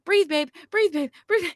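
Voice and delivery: high, sing-song coaching of breathe; coaching partner. Retold or imitated. Sayla's dad coaching his partner through labor, high and sing-song. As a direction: cheer her through each breath. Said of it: "Sayla's dad's going".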